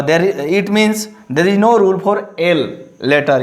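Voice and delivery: ordinary talk, nothing marked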